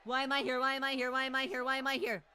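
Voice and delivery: high, loud chant-like yelling; loud rattled outburst; panicked repetition. Real, not acted. Streamer panics at being in battle.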